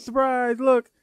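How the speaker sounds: high, mock-thrilled reveal; excited surprise. Partner imitating girlfriend unveiling gift, high excited voice. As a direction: imitate her showing off the gift. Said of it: "she goes".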